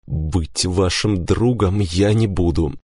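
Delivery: ordinary delivery, nothing marked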